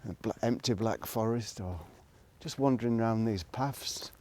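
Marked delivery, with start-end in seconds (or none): none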